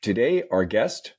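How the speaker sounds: ordinary delivery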